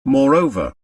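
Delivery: usual speaking voice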